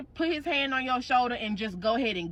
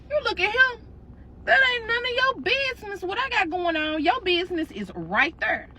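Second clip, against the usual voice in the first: sassy comeback; 8 semitones higher than usual. Acted. Snapping back at ex.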